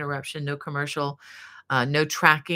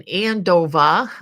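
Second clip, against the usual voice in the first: put-on playful stab at a Massachusetts accent, louder and drawn out; 2 semitones higher, 7 dB louder, slower than usual. Host jokes about living up in Andover, attempted Massachusetts accent. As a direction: Andover as "AN-doh-vah", dropped R, Massachusetts style. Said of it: "best I can do for my Massachusetts accent".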